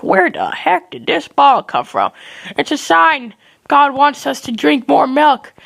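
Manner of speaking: high, mock-dramatic crowd voice; astonished onlookers. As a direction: proclaim it like an awestruck bystander, hammed-up. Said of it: "everyone's looking up like".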